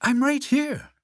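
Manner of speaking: high-pitched reassuring call; Frog's voice. A narrator's reading. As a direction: call back to a frightened friend. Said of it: "said Frog".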